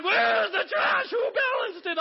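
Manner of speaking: loud, high, hammed-up yell; mock shout. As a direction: play the outraged pastor big. Pastor acts out himself shouting.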